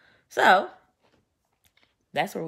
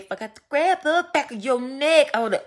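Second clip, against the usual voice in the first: higher, louder, laughing menace; quoting Cisco; 10 semitones higher, 5 dB louder than usual. Retold or imitated. Quoting Cisco's threat about grabbing a neck, laughing. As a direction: laugh through the threat, hammed up. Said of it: "like Cisco said".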